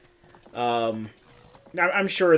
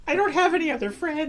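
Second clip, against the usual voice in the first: high squeaky voice; 13 semitones higher than usual. Acted. Voicing Link in high voice.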